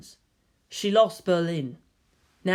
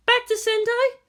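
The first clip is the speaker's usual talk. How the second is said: much higher, louder voice at the door; concerned guardian; 4 dB louder than usual. Acted. Masato asking Shinji at the door, concerned and higher. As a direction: ask with worry.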